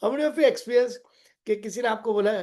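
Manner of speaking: ordinary delivery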